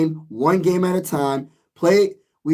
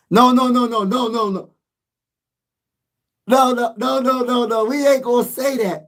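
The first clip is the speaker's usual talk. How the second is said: emphatic refusal; 4 semitones higher than usual. Real, not acted. Refusing a fan's pessimism.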